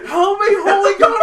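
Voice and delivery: very high, very loud hammed-up panic; imitated terrified shriek; mock scream for help. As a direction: scream it like a terrified teammate, laughing. Acting friend screaming for help.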